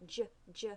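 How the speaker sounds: "j" as a short "juh", said twice; "j" as an isolated consonant, not the letter name; soft G sound. Shown as a demonstration. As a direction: sound out "j" slowly as "juh", with gaps. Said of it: "sometimes makes the j".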